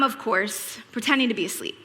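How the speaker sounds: usual speaking voice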